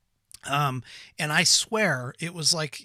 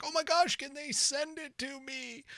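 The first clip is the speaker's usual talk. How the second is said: begging for the sandwich; 11 semitones higher, 5 dB quieter than usual. Retold or imitated. Craving the Reuben for days, high swooning plea. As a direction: swoon over it and beg. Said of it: "I was like".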